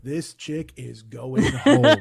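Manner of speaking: slow, laughing verdict. Retold or imitated. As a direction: drag it out while laughing. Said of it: "And then you're like".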